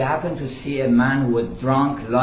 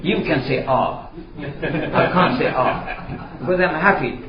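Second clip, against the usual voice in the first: put-on laughing drunk; 3 semitones higher than usual. Happy drunk explains his joy.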